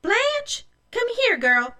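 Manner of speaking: high, sharp summons to a daughter; calling out. A narrator's reading. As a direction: call the girl over, voice pitched high and strong. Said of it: "Mother called Blanche".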